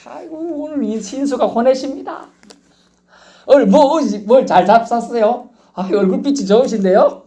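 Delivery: high, fawning sweet talk; butter up the stranger; flattering evangelist. Retold or imitated. Preacher flattering an elder while evangelizing, high fawning voice. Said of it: "제가 그런 식 전도를 해봤어요".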